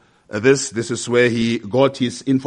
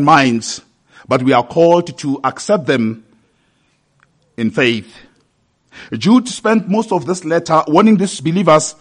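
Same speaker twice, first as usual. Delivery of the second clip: raised, earnest pulpit delivery; preaching; 6 semitones higher, 7 dB louder than usual. Real, not acted. Preacher exhorts brothers and sisters to accept scripture, earnest preaching. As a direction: press the point on the congregation.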